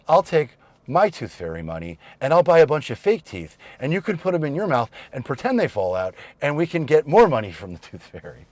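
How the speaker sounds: lightly voiced excited youngest daughter; retold cute daughter. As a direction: imitate a child pitching a clever plan. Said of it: "this was really cute".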